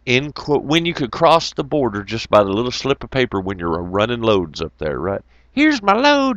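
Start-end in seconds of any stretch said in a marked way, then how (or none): 5.5-6.4 mock high eager voice; imitating trucker. Trucker showing his load at the border, mock eager. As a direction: play the eager driver at the border.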